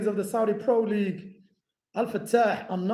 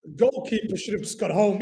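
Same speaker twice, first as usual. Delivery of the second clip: ordinary talk, nothing marked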